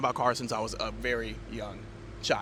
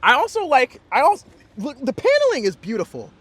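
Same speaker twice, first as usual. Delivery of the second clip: excited gushing; 10 semitones higher, 9 dB louder than usual. Real, not acted. Excited car reviewer gushing over interior.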